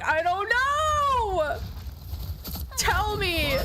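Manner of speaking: high, slow, stretched-out plea; mock exasperated wail. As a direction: drag it out like a helpless cry. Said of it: "And I'm like".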